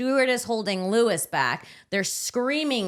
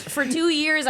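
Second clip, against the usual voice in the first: outraged; 9 semitones higher, slower than usual. Real, not acted. Outraged at paying ex's meals.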